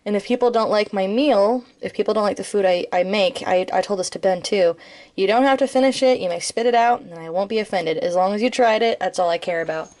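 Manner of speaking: normal talking voice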